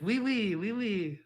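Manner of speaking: high, soft mock French; awful French accent. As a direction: toss it off as a cartoonish French refrain. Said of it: "That, that French accent was awful".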